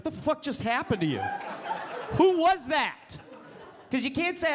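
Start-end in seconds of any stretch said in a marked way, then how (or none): 0.0-3.2 baffled bro asking; buddy stunned. Retold or imitated. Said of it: "your buddy's like".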